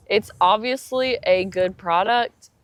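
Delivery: normal talking voice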